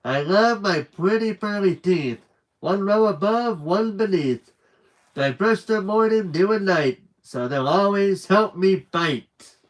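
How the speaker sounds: put-on sing-song children's poem; poem recital. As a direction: recite it brightly, rhythmic. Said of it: "Bugs recited his new poem".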